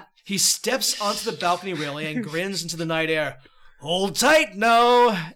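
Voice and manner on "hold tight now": slow, loud, drawn-out grin; booming giant voice. Acted. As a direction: savour it before the jump. Giant before leaping off balcony.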